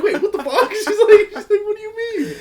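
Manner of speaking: high startled squeal; shocked disbelief. Retold or imitated. Host reacting to the book snatched back, high shocked. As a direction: sound stunned and outraged.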